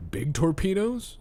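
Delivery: high, baffled question; playing dumb. Streamer playing obtuse about submarines, high confused guess. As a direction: guess wildly, feigning confusion. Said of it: "I'm like".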